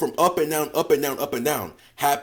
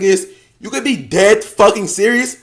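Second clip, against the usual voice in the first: ordinary talk, nothing marked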